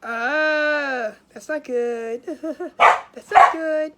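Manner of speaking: ordinary talk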